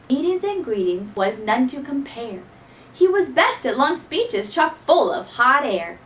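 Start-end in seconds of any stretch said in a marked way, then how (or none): none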